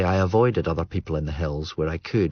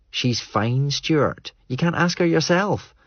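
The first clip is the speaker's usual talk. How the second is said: put-on higher, pointed young woman; Greer's voice; 8 semitones higher, slower than usual. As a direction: push it back at him, a bit higher. Greer's voice in dialogue.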